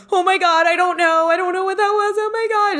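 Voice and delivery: high-pitched, panicky retelling of the friend's shock; imitating freaking out. Retelling how Ambi panicked on the phone, high mock panic. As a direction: squeal it out in mock alarm. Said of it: "And starts freaking out".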